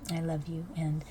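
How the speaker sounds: normal talking voice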